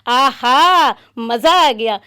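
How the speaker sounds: licking lips over a favourite treat; delighted at the food. Acted. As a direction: savour it, then burst out happy. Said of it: "मुस्कुराता है".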